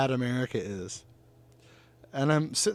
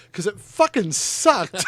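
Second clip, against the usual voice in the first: louder, slow, each word hammered out; defiant retort; 6 semitones higher, 8 dB louder, slower than usual. Retold or imitated. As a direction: land the punchline slowly and hard. Bar argument retold.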